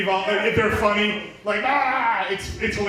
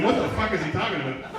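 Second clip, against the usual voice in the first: confused audience member; faster than usual. Retold or imitated. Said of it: "If you're going".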